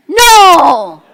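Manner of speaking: huge, high, drawn-out mock-furious yell; angry shout. Fuming wife answering husband at the door, comic angry shout. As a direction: explode on one long "No", hammed up for laughs. Acting out her angry reply.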